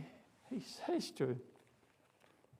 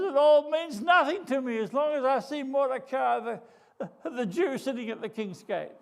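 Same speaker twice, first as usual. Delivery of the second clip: hammed-up whiny crying, high and loud; mock blubbering; 8 semitones higher, 8 dB louder than usual. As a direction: play the blubbering twit, mock sobbing. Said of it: "He starts to cry and he says".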